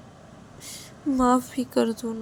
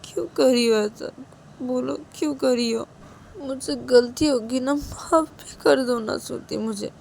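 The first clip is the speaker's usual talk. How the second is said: crying plea; 7 semitones higher than usual. A narrator's reading. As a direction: beg for forgiveness while sobbing. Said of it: "रोने का आवाज़ सुनती है". (रोते हुए माफ़ी माँगना)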